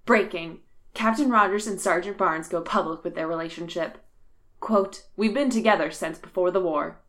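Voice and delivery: crisp breaking news voice; news anchor read. Acted. Mock CNN news tweet.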